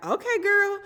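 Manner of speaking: put-on sarcastic okay girl. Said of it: "it's just like".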